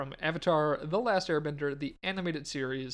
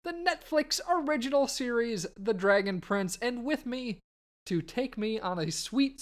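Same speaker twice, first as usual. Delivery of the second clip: bright, loud show-host welcome; 5 semitones higher, 3 dB louder than usual. Acted. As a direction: welcome the listeners like a radio host.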